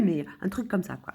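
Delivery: normal talking voice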